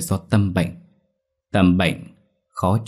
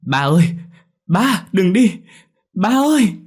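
desperate cry, much higher and louder; frantic calling out; 12 semitones higher, 5 dB louder than usual. A narrator's reading. Daughter crying out to her dying father, frantic high scream. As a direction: shriek after someone who is leaving for good. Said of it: "Tôi điên cuồng lao đến gọi".

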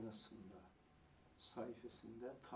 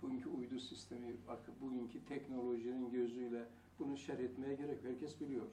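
ordinary delivery

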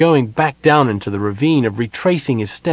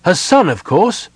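higher, louder, impatient answer; 8 semitones higher, 6 dB louder than usual. A narrator's reading. Sylvan tells the elf who he is, impatiently. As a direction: answer as if it were obvious. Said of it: "Sylvan asked impatiently".

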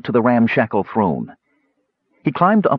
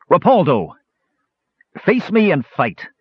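crying out; 7 semitones higher than usual. Acted. Knight challenging the hidden king to fight, crying out. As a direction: cry out a challenge to your foe. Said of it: "Sturm cried".

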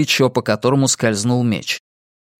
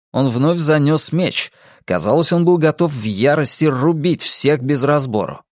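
plain reading voice

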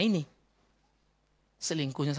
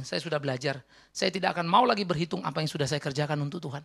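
usual speaking voice

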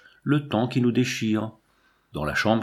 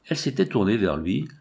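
usual speaking voice

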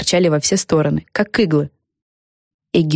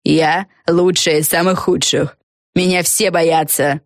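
slow, understated menace; hissed; slower than usual. Acted. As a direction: boast slowly, with a touch of venom. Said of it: "прошипела Фелисити Бет". (медленно, с лёгкой угрозой)

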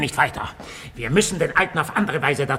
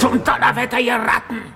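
higher, louder cursing; furious outburst; 8 semitones higher, 4 dB louder than usual. Acted. Boss curses his bungling henchmen, furious. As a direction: blow up at the fools.

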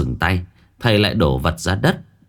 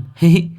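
put-on child giggle; 11 semitones higher, 4 dB louder than usual. Kids laughing at shaman.

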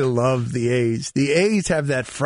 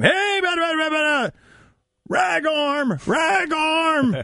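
heckling shout; 12 semitones higher than usual. Retold or imitated. Marlins players heckling the Yankees from the dugout, shouting. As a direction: yell taunts across at the pitcher. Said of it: "they're just shouting at the Yankees".

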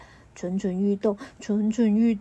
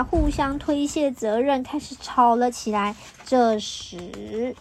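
ordinary delivery

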